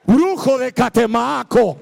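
loud, drawn-out stage-magic call; mock incantation. Preacher does a trick as illustration, mock incantation. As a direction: boom it slowly like magic words.

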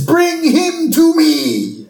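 high, very drawn-out villainous command; hammed-up evil boss summons; Mister Burns. Boss orders his assistant to fetch Homer, hammed-up imperious villain.

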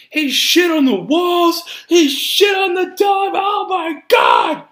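high, drawn-out hysterical shouting; over-the-top comedy meltdown; movie quote impression. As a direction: shout in horrified mock disbelief, stretching it out. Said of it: "that's for people who don't know that one".

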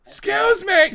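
high, loud screeching call; hammed-up shrieking customer; mock screech. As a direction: screech across the lobby like Vickie Guerrero. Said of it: "She sounded like Vicky Guerrero".

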